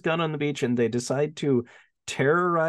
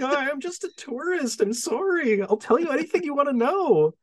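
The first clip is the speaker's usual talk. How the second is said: higher, lightly voiced frightened tourist; pleading tourist; 10 semitones higher than usual. Beaten tourist pleads with cop, hammed-up fear. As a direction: beg for mercy in a mock whimper.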